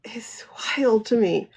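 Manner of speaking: hushed, breathy disbelief; whispered aside. Real, not acted. To viewers, a stunned whisper.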